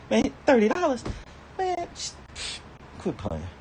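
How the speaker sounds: shocked high-pitched disbelief; can't believe the price, voice jumps way up; a real squeak of outrage; incredulous. Real, not acted. Outraged at a thirty-dollar meal.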